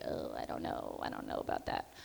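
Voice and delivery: low, breathy-soft doubtful reply; half-whispered, unsure whether to do it; hesitant mumble. Retold or imitated. Student nurse doubting the nudge, in a hesitant half-whisper.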